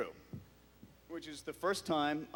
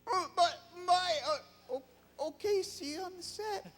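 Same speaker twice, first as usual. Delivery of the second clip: flustered. Retold or imitated. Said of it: "I'm like".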